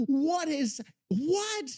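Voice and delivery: high, drawn-out bewildered wail; slow down and whine it in disbelief; real baffled despair; exasperated disbelief. Real, not acted. Bewildered by the failing voice clip.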